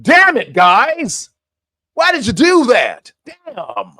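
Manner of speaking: hammed-up scolding, pitch swooping high; badly faked anger at the guys; Trump, mock pissed. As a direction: pretend outrage, put on for show. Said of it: "like he was pissed at Israel".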